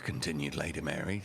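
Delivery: ordinary delivery, nothing marked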